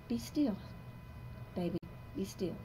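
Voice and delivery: tender soothing. Real, not acted. Soothing her inner self.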